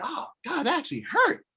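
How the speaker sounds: high-pitched hurt exclamation, acted out; mock pained reaction. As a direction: imitate someone smarting from a knockdown. Knocked down, voices the loser.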